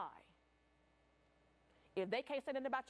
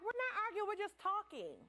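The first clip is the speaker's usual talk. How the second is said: insistent denial; 9 semitones higher than usual. Retold or imitated. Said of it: "we were like".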